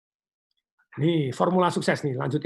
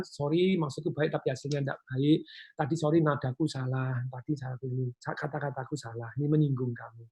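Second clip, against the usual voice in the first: softer, lower, gentle apology; sincere apology; 4 semitones lower, 7 dB quieter than usual. Shown as a demonstration. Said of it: "minta maafnya harus dengan nada yang tepat". (minta maaf dengan lembut dan tulus)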